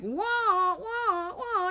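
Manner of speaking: high, yappy wah-wah squeals; gushing over a fancy lady's purse dog with puppy noises; excited dog noises. Retold or imitated. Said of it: "dog energy".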